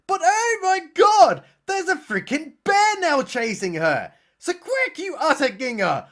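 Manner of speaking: high-pitched, loud hammed-up panic; over-the-top horror-film alarm; mock alarm. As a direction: yell a pretend warning to someone being chased. Mock warning to bear chase victim.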